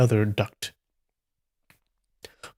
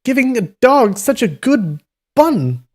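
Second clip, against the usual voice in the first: swearing; 13 semitones higher, 5 dB louder than usual. Acted. Inn boy raging at the wasted bun, outraged cry. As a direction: howl it in outrage. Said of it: "swore the inn boy".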